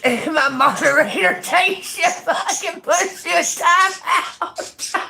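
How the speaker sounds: high, slow words squeezed through laughter; real breathless laughing fit; cracking up. Real, not acted. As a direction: lose it completely mid-sentence. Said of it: "got me fucking cracking up".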